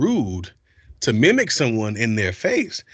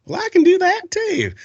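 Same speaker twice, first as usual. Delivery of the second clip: high pitched Southern; 5 dB louder than usual. Shown as a demonstration. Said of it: "kinda high pitched".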